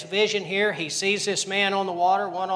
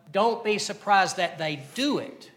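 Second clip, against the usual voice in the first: ordinary talk, nothing marked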